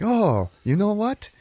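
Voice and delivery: high, drawn-out character voice; hammed-up high reconciliation; mock heartfelt realization. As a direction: lift it high in dawning wonder. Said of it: "they're like".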